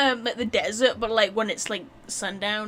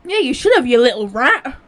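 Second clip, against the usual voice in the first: loud playful scolding; loud teasing; 15 dB louder than usual. Real, not acted. Scolding game character playfully.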